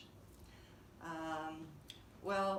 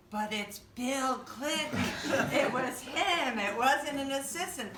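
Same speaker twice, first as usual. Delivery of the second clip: incredulous protest; 8 semitones higher, 10 dB louder than usual. Retold or imitated. Assistant protesting to his boss, higher and louder in disbelief. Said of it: "And he went".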